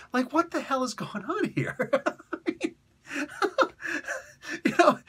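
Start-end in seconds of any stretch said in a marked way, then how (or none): 1.9-5.0 high laughing giggle. Real, not acted. Host cracking up at his own bit, laughing. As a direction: laugh through it. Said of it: "laughs heard".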